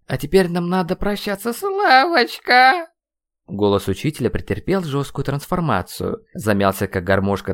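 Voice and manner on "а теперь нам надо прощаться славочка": rising into a drawn-out squeak; voice transforming. A narrator's reading. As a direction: morph from mentor into squeaky taunter. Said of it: "сменил тембр". (голос срывается в протяжный писк)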